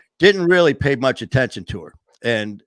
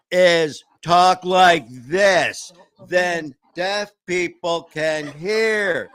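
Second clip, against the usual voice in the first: slow, loud, higher and overenunciated; exaggerated slow talk; 5 semitones higher, 4 dB louder, much slower than usual. Shown as a demonstration. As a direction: stretch every word out loudly as if the listener is deaf. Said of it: "all you have to do".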